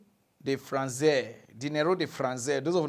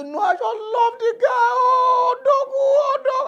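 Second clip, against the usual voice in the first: much higher, louder hammed-up sobbing fan; crying shouting fan; 11 dB louder, slower than usual. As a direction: wail it out like an overwhelmed fan. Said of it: "He say".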